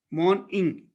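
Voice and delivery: "Morning" broken into morn / ing; morn-ing division. Shown as a demonstration. Teacher showing two syllables, split morn-ing. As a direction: keep the N on the first syllable of "Morning". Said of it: "a syllable, and ing".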